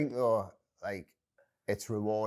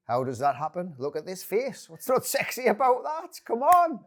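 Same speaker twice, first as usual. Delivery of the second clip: mock boastful, building; 11 semitones higher, 7 dB louder than usual. Hammed-up pitch to the interviewer, rising higher and louder. Jokey self-promotion, pitch climbing.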